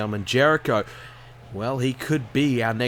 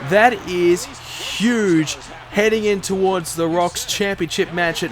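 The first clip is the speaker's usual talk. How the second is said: higher play-by-play winding down; wrestling commentator; 5 semitones higher than usual. Acted. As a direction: call the finish, then land it slowly. Finish commentary.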